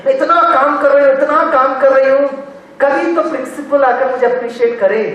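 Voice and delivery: high, insistent mock grumbling; acted complaint. Overworked teacher wishing the principal would appreciate them, acted high complaint. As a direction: pitch it up and plead for recognition. Acts out an unappreciated worker's complaint.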